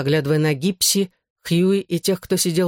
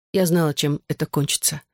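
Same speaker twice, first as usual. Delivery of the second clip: plain reading voice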